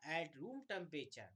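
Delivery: At room temperature said slowly, word by word; slow dictation. Shown as a demonstration. Teacher dictating the exact phrase to write. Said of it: "क्या बोलोगे?".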